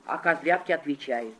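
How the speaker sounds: ordinary delivery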